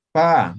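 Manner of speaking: "Pa" plain unaspirated, like Spanish; simple pa. Shown as a demonstration. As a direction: say "Pa" simple, no puff of air. ("Pa" simple, igual que en castellano)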